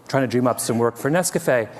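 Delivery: ordinary talk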